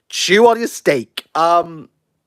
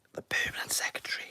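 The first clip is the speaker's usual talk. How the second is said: hushed whisper; 17 dB quieter, faster than usual. Real, not acted. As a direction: whisper it under your breath. Hushed as announcement starts.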